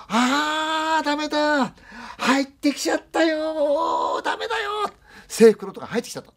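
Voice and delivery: very high, loud, drawn-out wail of terror; terrified grandmother crying out; panicked scream. Retold or imitated. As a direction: shriek it like the ghost is inside. Grandmother panics, man came in.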